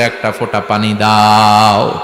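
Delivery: low, slow singsong plea; imitated desperate call, voice kept low; retold cry for water. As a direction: draw the last word out like a begging chant. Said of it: "চিৎকার দিয়ে বলবে".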